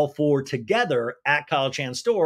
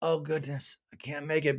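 mock feeble excuse; 6 dB quieter than usual. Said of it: "it looks just like".

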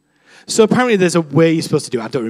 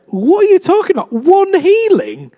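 much higher, mock-baffled squeak; incredulous inner voice; 12 semitones higher, 3 dB louder than usual. Preacher's brain scoffs at her bullet point, high mock disbelief. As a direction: play your own brain as baffled, high and stretched. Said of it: "suddenly my brain was like".

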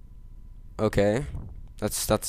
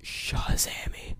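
whisper; 11 dB quieter, slower than usual. Acted. Whispering the magic word.

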